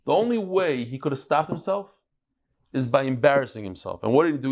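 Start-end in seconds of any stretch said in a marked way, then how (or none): none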